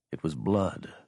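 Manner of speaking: usual speaking voice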